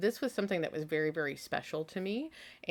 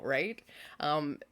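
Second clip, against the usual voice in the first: ordinary talk, nothing marked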